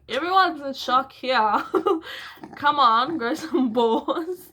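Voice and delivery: loud, high, scornful retort, slow on the last words; retold exasperated yell. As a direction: snap back at him in disbelief. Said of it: "And I was like".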